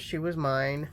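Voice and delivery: usual speaking voice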